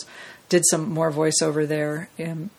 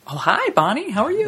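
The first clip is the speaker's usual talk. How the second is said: bright greeting; 7 semitones higher than usual. Retold or imitated. Retold greeting.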